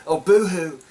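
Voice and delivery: retold sarcastic pity; mock sympathy. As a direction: dismiss their grief with a jeer. Megan mocks concern over killings.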